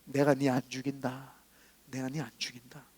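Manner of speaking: a quiet, solemn echo of God's words; hushed voice of God. Retold or imitated. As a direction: say it softly like a divine whisper. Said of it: "육신의 귀로부터 모함으로 들렸어요".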